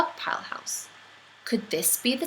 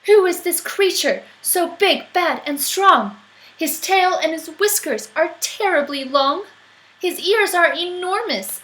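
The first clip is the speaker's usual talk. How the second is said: higher, louder frightened wonder; child fearing the giant shadow; awed child; 7 semitones higher, 4 dB louder than usual. Acted. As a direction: stare up at a huge shadow.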